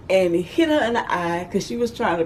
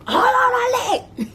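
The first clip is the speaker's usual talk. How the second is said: retold scream. Mama screaming at her child to hold her leg, laughing. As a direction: yell it at the kid, laughing. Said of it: "she screaming for me".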